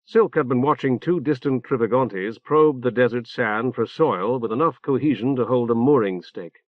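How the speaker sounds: ordinary delivery, nothing marked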